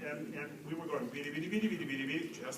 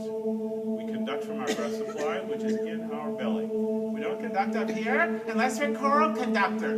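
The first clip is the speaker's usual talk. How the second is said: raised, half-sung coaching voice, a bit higher and louder; choir rehearsal coaching; 7 semitones higher, 7 dB louder, slower than usual. Acted. Choir director to singers, coaching in a half-sung voice. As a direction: coax the choir into a round, belly-supported sound.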